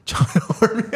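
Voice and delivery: giggling through the name; laughing. Real, not acted. Co-host laughing at the fan nickname, amused chuckle.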